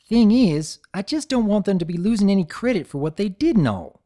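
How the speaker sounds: high earnest country pony; insisting on giving credit; folksy and sincere; Applejack voice. Acted.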